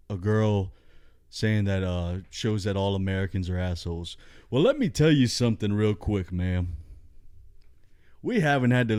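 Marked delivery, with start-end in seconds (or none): none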